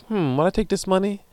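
high, mock-thoughtful hmm; a hammed-up thinker; mock pondering. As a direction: pretend to weigh it up. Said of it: "not to be like".

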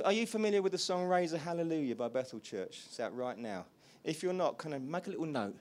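usual speaking voice

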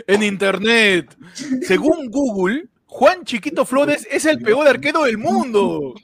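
slow, deliberate reveal; slow announcement. Real, not acted. Telling friends what Google says, slowly and deliberately. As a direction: spell out the news slowly, phrase by phrase.

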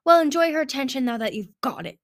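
loud, resentful growl. A narrator's reading. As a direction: growl it at a rival. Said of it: "All-Knowing growled".

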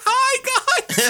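very high, sing-out teacher greeting; yelled greeting. Retold or imitated. Teacher driving by spots students, yelled greeting. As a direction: call out high to kids from a car. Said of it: "Who's honking and yelling?".

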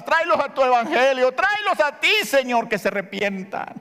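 high, pleading prayer cry; fervent preaching. Acted. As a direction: cry out to the Lord for the family. (clamor de oración ferviente y agudo)

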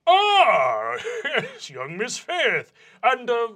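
put-on theatrical, slow young Miss Faith; vice principal voice. DM as vice principal greeting the student, mild pompous lift. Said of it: "Goldenhord looks up and goes".

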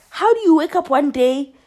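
usual speaking voice

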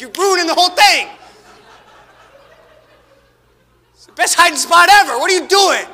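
loud, high exasperated complaint; a dad telling his kid they've ruined the hiding spot, loud and high. Retold or imitated. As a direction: scold the giggler in mock despair.

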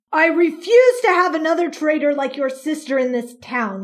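loud, contemptuous spitting of words; police chief spitting scorn at the mother; spat. Acted. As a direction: spit the threat at her. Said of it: "he spat".

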